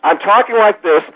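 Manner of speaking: high, animated everyday voice; ordinary talking voice, lifted. Shown as a demonstration. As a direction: pitch it up, away from the announcer. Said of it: "all of a sudden I'm doing".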